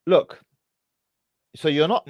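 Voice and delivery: ordinary talk, nothing marked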